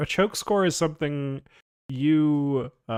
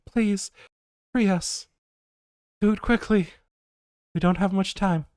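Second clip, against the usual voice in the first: raised, urgent plea from captive voices; pleading trapped voices; 7 semitones higher, 5 dB louder than usual. Acted. Game master as voices begging for rescue, urgent plea. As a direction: plead as the voices in the tubes, pitched up.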